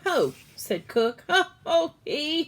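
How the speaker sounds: high, loud ho ho laugh; laughing cook. Acted. Cook laughing at the mouse, high loud laughter. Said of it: "heard the sound of laughter".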